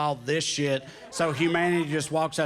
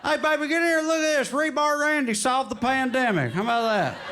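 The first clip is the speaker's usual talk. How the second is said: high, quick call to his wife; higher-voiced mock amazed yokel; excited guy showing off a meme; 8 semitones higher than usual. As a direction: eagerly share a big discovery, pitched up. Excited redneck quoted in bit.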